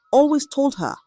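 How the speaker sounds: ordinary delivery, nothing marked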